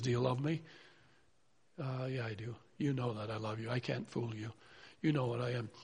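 a quiet, sheepish Peter answering Jesus; hushed quoted exchange. Retold or imitated. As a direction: let it drop into a humble hush. Jesus and Peter dialogue retold.

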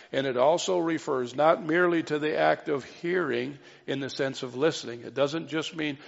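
ordinary delivery

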